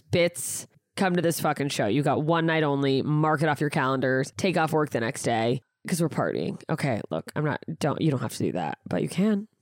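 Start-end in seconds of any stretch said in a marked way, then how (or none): none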